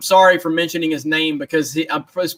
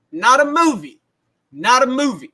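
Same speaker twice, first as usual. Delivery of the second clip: Allen Iverson rant; 4 semitones higher than usual. Retold or imitated. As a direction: bark it like the famous press conference. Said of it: "the Allen Iverson meme".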